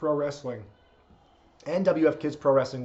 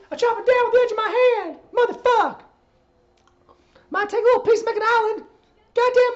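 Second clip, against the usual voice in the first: much higher, loud, fast squealing manager voice; 7 dB louder than usual. Pretend. Streamer sings Voodoo Child for a viewer, squealing loud manager voice. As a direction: screech the lyrics like a furious old wrestling manager. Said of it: "I'll do [name] as [name]".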